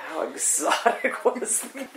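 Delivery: high-pitched, laughing amusement. Real, not acted. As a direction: break into a laugh while saying it. Laughs at her own awe.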